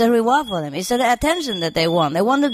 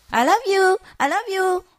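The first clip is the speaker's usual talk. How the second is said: enticing parrot; 11 semitones higher than usual. Retold or imitated. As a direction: coax like a pet bird, pitched way up. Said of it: "he wants to entice me to him".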